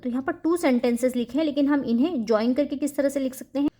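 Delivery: usual speaking voice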